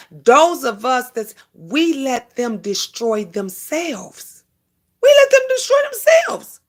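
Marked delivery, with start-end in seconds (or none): 5.0-6.6 much higher, louder repeat of the line; high emphatic repeat. Shown as a demonstration. Preaching to viewers about haters, repeating it high and loud. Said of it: "repeated with emphasis".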